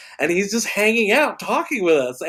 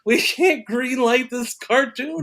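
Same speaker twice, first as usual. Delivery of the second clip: baffled disbelief; 7 semitones higher than usual. Retold or imitated. Acts out group's baffled reaction.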